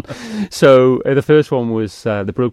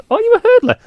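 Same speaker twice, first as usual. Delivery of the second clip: much higher, louder, excited question; mock excited casting agent; 9 dB louder, faster than usual. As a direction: mimic the agent's eager surprise.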